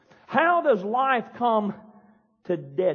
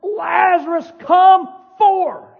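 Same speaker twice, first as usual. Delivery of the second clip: loud, high, commanding yell; booming summons at full voice; mighty shout; 7 semitones higher, 7 dB louder, much slower than usual. Acted. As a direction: bellow it to wake the dead. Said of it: "He said in a voice that I can't even use".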